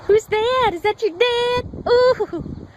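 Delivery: high, cooing questions; excited pet talk. Real, not acted. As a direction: coo it high to the dog. Excites dog about returning owner.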